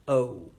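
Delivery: "oh" starting big and closing round; open-mouthed vowel. Shown as a demonstration. Teacher shows students the mouth shape, "oh" carefully rounded. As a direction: say "oh" as a slow, isolated teaching sound. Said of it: "you start big".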